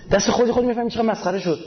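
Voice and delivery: ordinary talk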